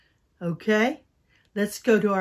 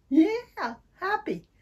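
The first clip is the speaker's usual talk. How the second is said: bright, higher happy yeah; 9 semitones higher, slower than usual. Shown as a demonstration. Children's teacher showing a happy voice. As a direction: sound happy for the kids. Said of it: "Can you get happy?".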